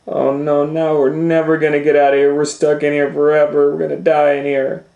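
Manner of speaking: high, loud frantic wail; lost children panicking in the forest, high and loud; panicked children. A narrator's reading. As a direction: cry out in despair.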